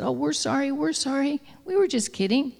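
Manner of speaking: high, hurried pleading sorry; cowering apology. Acted. Frightened wolves apologizing to Wolf, high and hurried. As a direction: back off, scared and apologetic.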